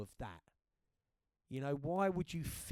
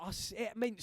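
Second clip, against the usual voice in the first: ordinary talk, nothing marked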